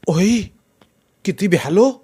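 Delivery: startled little outburst. A narrator's reading. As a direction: react as if just given a fright. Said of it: "संदेश दचकला".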